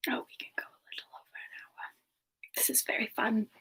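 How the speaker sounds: hushed whispered aside. Real, not acted. Streamer quietly telling chat the stream can run long. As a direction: tell them softly as if a secret.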